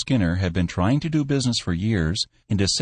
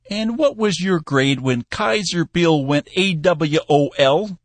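put-on high, slow, probing old man; a shrewd old boss digging; old Cappy; 9 semitones higher, 3 dB louder than usual. A narrator's reading. Cappy quizzing Bill on his army rank, high old man.

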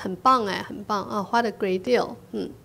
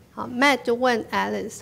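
ordinary talk, nothing marked

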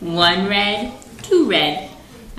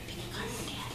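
very soft, voiceless whisper; 17 dB quieter, much faster than usual. Shown as a demonstration. Parent has child pick a card for Daddy, whispering. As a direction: whisper the instruction under your breath. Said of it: "Can we whisper?".

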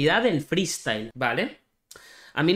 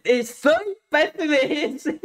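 high, squeaky, stretched-out words; helpless high-pitched wheeze of amusement; cracking up; 11 semitones higher, much slower than usual. Real, not acted. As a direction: barely get it out through laughter. Cracking up at the clip.

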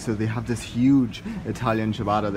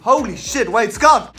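much louder, higher call; excited shout; 10 semitones higher, 10 dB louder, slower than usual. Real, not acted. Vlogger calling to friend Scott about a deal, excited shout. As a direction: shout to stop him, thrilled.

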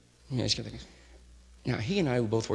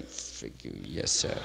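much lower "Frig you, yes, sir." with mock respect; understated resentful mock politeness; sneering aside; 13 semitones lower, 6 dB quieter, much slower than usual. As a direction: grumble the insult, then the sir. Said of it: "They're going".

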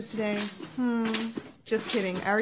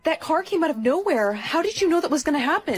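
higher, louder shocked questioning; astonished; 4 semitones higher, 4 dB louder than usual. Acted. Friend after a near miss with a car, astonished. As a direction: blurt it out in amazement.

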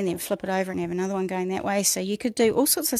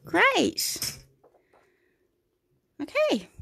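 cheery; 12 semitones higher, much slower than usual. Real, not acted. Cheerful check-in with viewers.

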